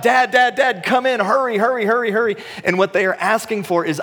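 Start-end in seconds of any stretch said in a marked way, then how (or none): 0.0-2.4 put-on high, urgent child's plea; higher mock kid calling for help; kids crying out. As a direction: beg Dad to hurry like a child. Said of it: "they start crying out".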